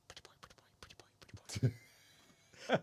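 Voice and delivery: tiny whispered bird chatter; muttering pet bird. Retold or imitated. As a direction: repeat it rapidly under the breath like a little bird. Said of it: "under his beak".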